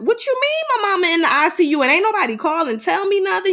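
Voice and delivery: mock outraged confrontation. Daughter confronting nurse about mother moved to ICU, indignant.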